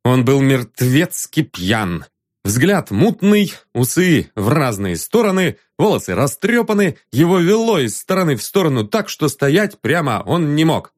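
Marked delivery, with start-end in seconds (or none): none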